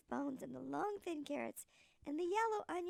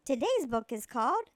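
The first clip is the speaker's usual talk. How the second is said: loud announcing; 12 dB louder, slower than usual. Real, not acted. Announcing the book title.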